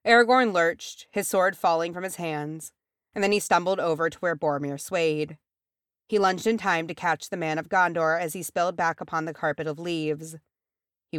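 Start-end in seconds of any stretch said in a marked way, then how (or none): none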